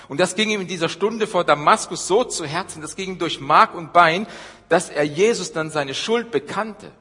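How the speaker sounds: loud, rising preacher's climax; preaching at full force. Acted.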